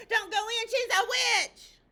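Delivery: high, loud urgent chicken warning; frantic warning. Acted. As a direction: shout the warning, slowing on witch. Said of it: "she said".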